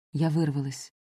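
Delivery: ordinary delivery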